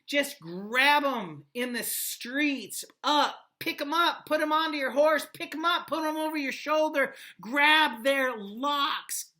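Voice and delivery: high, loud, hammed-up soldier's glee; mock gleeful brutality. Teacher hams up soldiers snatching kids, high and loud. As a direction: act out the plunder with relish.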